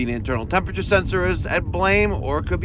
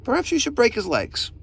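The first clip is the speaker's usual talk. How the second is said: ordinary delivery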